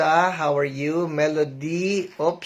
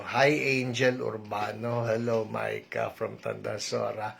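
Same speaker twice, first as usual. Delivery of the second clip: softer, hushed greeting; lowered voice; 6 semitones lower, 8 dB quieter than usual. Real, not acted. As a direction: bring the voice right down. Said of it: "Susubukan ko ibaba yung boses ko". (mahinang boses, ibinaba ang boses)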